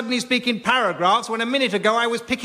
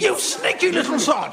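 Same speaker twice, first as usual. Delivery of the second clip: higher, indignant exclamation; outraged ghost; 6 semitones higher than usual. Acted. Ghost shouting at the medium, higher outrage. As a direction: call him out in disbelief.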